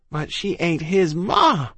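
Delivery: high, drawn-out cry of protest; shouted protest. A narrator's reading. Child protesting to the doctor about his ma, shouted. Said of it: "I shouted".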